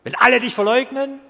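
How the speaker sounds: high, loud cocky brag; arrogant boast. Retold or imitated. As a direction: boast like a know-it-all. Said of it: "diese Arroganz von Petrus".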